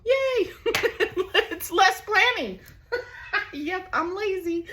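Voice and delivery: high giggling cheer; giggly cheer. Real, not acted. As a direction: squeal yay then laugh. Cheers, then laughs at herself.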